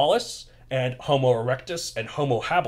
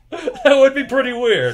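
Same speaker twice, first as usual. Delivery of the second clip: laughing; 11 semitones higher, 8 dB louder than usual. Real, not acted. Laughing at the joke.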